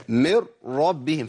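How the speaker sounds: من ربهم recited slowly, ن vanished into a held doubled ر; slow idgham demo. Shown as a demonstration. Teacher to class, slow recitation of idgham. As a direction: draw out من ربهم as مرّربهم. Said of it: "أقول".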